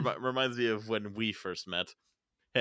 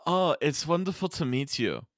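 put-on German accent, higher voice; 6 semitones higher, 3 dB louder than usual. Co-host shows off the friend's German voice, German accent. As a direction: greet someone in a German accent. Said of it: "you're good at that".

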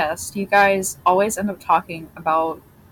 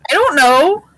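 squeaky high voice; high squeak; 9 semitones higher, 8 dB louder than usual. Acted. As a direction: pitch it way up. Squeaky mock voice.

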